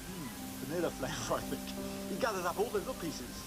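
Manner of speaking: measured showman narration over music; magician's stage patter. Acted. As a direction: present each trick with a flourish. Magic show presenter patter.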